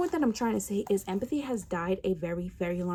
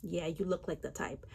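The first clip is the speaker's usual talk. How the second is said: sneering whisper; 4 semitones lower, 7 dB quieter than usual. Retold or imitated. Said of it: "They're like".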